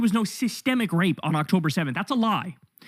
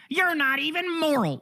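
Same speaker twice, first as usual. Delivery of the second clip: smug lecture; 7 semitones higher than usual. Retold or imitated. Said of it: "any sort of smug lecture".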